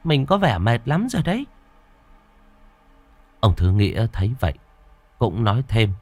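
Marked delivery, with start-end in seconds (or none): 0.0-1.5 lightly higher wife, concerned; wife's higher voice. A narrator's reading. Wife urging her tired husband to go lie down, concerned. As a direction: urge him gently to rest.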